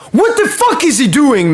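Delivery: can't believe what he's seeing; exasperated outburst. Real, not acted. Fan reacting to Barcola's penalty, high exasperated outburst.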